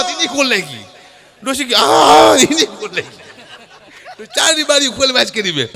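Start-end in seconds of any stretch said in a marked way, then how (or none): 1.7-2.4 a high, loud hawk broken by a laugh; throat-clearing. Retold or imitated. Grandma clears her throat to open the window, laughing hawk.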